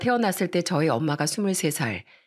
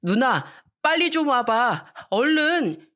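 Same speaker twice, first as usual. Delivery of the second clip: higher, slow and pleading little-brother call; urgent little brother; 6 semitones higher, 3 dB louder, slower than usual. Acted. Younger brother calling sister urgently from his room, higher pitched and pleading. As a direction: drag it out, begging big sister to hurry. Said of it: "남동생이 절 급하게 부르는 거예요".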